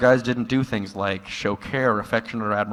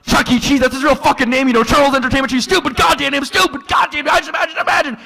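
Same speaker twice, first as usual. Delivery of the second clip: much higher, much louder manic shouting; frantic blurted rant; 7 dB louder, faster than usual. Retold or imitated. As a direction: explode with it like it can't be held in. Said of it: "But then he blurts out".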